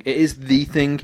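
"the" said as an emphatic "thee"; stress on THE. Acted. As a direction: lean hard on "the", pronounced thee. Corrects a thing to the thing.

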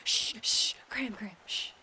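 hushed shushing whisper. Pretend. Hushing friend near fox.

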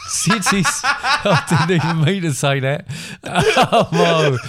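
cracking up at her own slip, high and loud; laughing. Real, not acted. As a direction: laugh through the correction. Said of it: "I'm getting overexcited".

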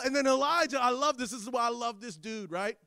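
ordinary talk, nothing marked

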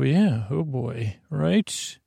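ordinary talk

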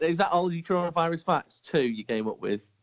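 usual speaking voice

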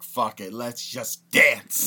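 loud, drawn-out cry to dance; loud, slow party whoop; party-mood outburst. Acted. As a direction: stretch it out like a dance party kicking off. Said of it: "I'm like".

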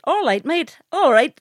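high, punchy Belfast greeting; Belfast accent. Shown as a demonstration. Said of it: "I like this part of, uh, of Belfast".

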